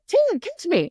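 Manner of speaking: high, loud mock call to a dragon. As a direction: call out as if leaping. Retells rider calling to her dragon.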